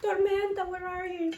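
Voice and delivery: high sing-out of a pet's name; calling her. Retold or imitated. Owner searching for their ferret Tormenta, high calling voice. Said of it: "calling her".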